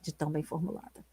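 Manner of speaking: whispered, moved admiration; soft, moved whisper of praise; hushed awe. Real, not acted. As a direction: breathe it out in quiet awe. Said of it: "eu vou chorar".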